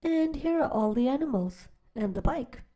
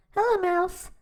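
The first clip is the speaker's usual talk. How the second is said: cheerful duck greeting; 8 semitones higher than usual. Acted. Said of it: "said Duck".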